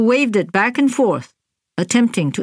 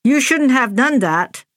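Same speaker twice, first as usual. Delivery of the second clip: stern voice; 6 semitones higher than usual. A narrator's reading. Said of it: "in a stern voice".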